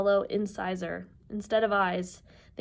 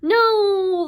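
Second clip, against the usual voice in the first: long, high, loud refusal; yelling; 12 semitones higher, 11 dB louder than usual. Acted. Sisters as Percy threatens the eye, yelling. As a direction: yell out in alarm. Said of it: "the Grey sisters yelled".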